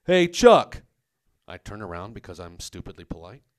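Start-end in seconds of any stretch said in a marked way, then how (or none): 0.0-0.8 loud, higher call across the street; mock yell. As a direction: shout after him by nickname. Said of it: "guy one yells to me".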